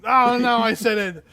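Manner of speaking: loud, high cry of dismay; playful dismay. Real, not acted. Game master slipped out the phrase, loud playful dismay. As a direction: wail it as if caught out.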